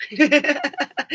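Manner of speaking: high, laughing proud reply; real laughter on a cheeky brag; laughing boast. Real, not acted. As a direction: brag it through a giggle.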